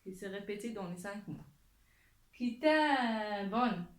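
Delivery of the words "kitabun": slow, loud, drawn-out word; lecture appuyée. Shown as a demonstration. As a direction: stretch Kitabun so learners hear each sound. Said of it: "on écoute bien la lettre". (lecture lente et forte)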